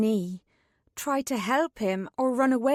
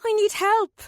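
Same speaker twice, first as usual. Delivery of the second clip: high, louder desperate yell; 10 semitones higher, 5 dB louder than usual. A narrator's reading. Katrin yells for Myrna amid the fighting, louder. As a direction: shout for help over the chaos. Yelling for help in chaos.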